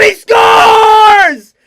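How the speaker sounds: full, high scream of the goal; goal shout. Real, not acted. Commentator screaming the goal for his team. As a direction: explode on the goal call. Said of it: "craziest voice crack ever".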